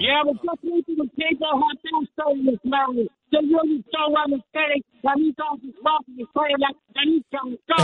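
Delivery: caller sounding like in a tunnel; muffled echoing phone line. Real, not acted. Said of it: "It sounds like you're in a tunnel".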